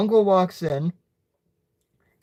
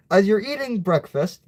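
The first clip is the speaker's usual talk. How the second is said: ordinary delivery, nothing marked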